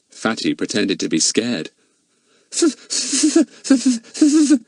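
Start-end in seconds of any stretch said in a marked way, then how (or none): 2.5-4.6 high, loud pretend stammer. A narrator's reading. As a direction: stammer as if scared. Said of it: "he stammered".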